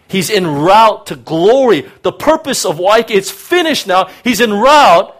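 a preacher rising to full force; fervent preaching. Real, not acted. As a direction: build to a shout of glory. Preaching climax.

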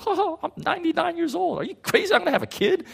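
high, laughing mock disbelief; high-pitched incredulous laugh; giggling disbelief. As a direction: giggle it out like an old man told he'll be a father. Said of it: "giggling. He's like".

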